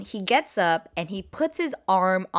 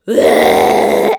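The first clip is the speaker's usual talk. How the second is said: loud, low retching noise; long guttural retch, played loud; imitated vomiting; 12 dB louder than usual. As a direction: heave out a big mock puke. Imitates date vomiting at concert.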